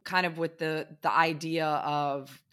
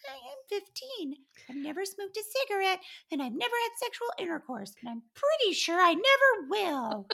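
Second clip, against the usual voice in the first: put-on much higher, fast, prissy little-girl voice; prim naive teen; faster than usual. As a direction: insist on your innocence like a good girl. Her prim naive self.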